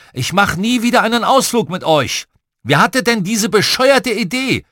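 high, loud exasperated rant; angry yelling. A narrator's reading. Dad fed up with fighting children, angry yelling. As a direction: yell like a parent at the end of their rope. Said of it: "Und Papa schrie".

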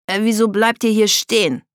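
irked question. A narrator's reading. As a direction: complain loudly about the hold-up. Tom beschwert sich übers Stehenbleiben. (laut und genervt gefragt)

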